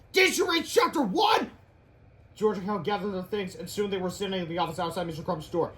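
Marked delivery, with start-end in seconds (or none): 0.1-1.5 high, slow shouted jab; mock the boys sharply; scornful scold. Teacher scolding.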